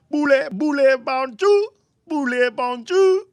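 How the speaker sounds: very high, put-on French voice; mock French accent. Streamer showing off made-up French, high mock French accent. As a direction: pitch it way up in pretend French.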